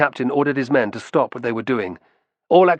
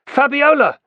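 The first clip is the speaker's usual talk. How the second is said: higher, louder shouted name; 6 dB louder than usual. A narrator's reading. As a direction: call her name across the dock. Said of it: "he shouted".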